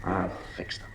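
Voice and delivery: breathy conspiratorial whisper. Acted. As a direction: whisper it, plotting under your breath. Husband plotting in the nursery.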